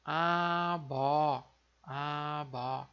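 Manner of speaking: "abó" com B sonoro no meio; coreano abeo. Shown as a demonstration. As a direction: diga "abó" devagar, B suave. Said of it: "por isso fica". (pronúncia coreana de "abó", devagar)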